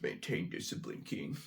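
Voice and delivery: very soft, low whisper; hushed self-talk. Acted. Bidder telling himself to hold back, hushed whisper. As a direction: whisper it to steady yourself.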